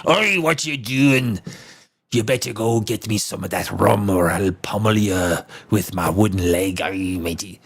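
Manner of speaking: a hint of a growly old sea dog threatening; mock pirate threat, low; pirate impression. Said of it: "I do my pirate impression".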